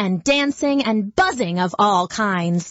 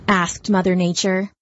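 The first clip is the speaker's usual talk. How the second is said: ordinary delivery, nothing marked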